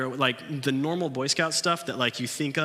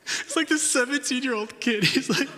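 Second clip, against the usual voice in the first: laughing while telling it, pitched way up; 13 semitones higher, 4 dB louder than usual. Real, not acted. Telling the group about the kid, laughing. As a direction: giggle through the words.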